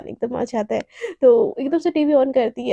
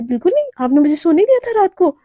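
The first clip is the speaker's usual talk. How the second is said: fast indignant retort; 4 semitones higher, 6 dB louder, faster than usual. Acted. Shivani argues back quickly.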